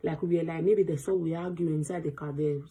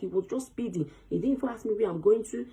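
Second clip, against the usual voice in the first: ordinary talk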